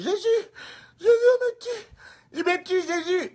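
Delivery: high-pitched panicked wail; mock cry. Evangelist crying that demon kills him, panicked mock wail. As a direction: shriek for rescue, hammed up. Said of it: "c'est lui qui crie".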